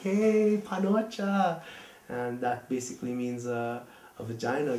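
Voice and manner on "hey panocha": higher playful call-out; friendly teasing call. Retold or imitated. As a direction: greet a buddy with a cheeky nickname. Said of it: "it's like".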